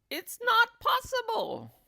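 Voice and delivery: put-on shrill old woman refusing to believe; Missus Twit protesting. A narrator's reading. Wife denying she's shrinking, high and loud. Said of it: "Missus Twit".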